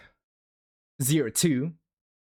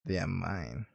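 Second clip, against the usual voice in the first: even lower and much softer, almost breathed; possessive whisper-low; 9 semitones lower, 8 dB quieter than usual. Pretend. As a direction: make "mine" a dark, hushed claim.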